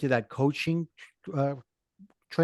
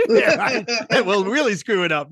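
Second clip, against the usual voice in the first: laughing; 6 semitones higher, 7 dB louder than usual. Real, not acted. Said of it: "especially my Brooklyn accent".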